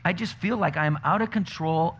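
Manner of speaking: usual speaking voice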